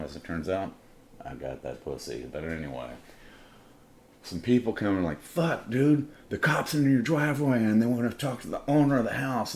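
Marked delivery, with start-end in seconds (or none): 5.2-9.5 high, loud panicky warning; nervous alarm. Retold or imitated. Party guests warning the host about cops, nervous and high. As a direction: blurt out the bad news, pitched up. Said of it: "they're all nervous and shit".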